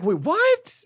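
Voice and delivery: high-pitched incredulous double take; baffled disbelief. Retold or imitated. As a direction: replay a stunned reaction, pitch jumping up. Acting out his baffled first reaction.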